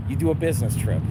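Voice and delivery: ordinary talk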